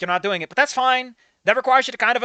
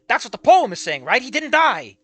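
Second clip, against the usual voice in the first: usual speaking voice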